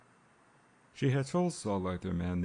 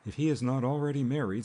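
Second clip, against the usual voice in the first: blunt; 6 semitones higher, 4 dB louder than usual. A narrator's reading. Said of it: "said Saul Arthur Mann bluntly".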